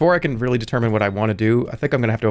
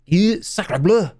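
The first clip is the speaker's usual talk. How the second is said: slow, high French exclamation; hammy French accent; 6 semitones higher, much slower than usual. Acted. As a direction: draw it out as an outraged cartoon Frenchman. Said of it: "With a French accent".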